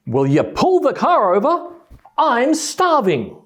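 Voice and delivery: high, loud plea, "starving" stretched slow; hungry wife twenty minutes later, loud and high; fed-up hungry wife. Retold or imitated. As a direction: demand he stop the car, drawing out the last word.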